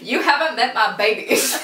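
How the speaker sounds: villain quote through giggles; laughing mock villain. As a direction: act out the villain while laughing. Joking villain line about snakes.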